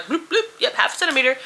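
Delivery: high, quick, dismissive doctor's check; mock a doctor breezing through an exam; doctor impression. Narrator mimics the doctor's careless check, high and offhand. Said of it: "The doctor was like".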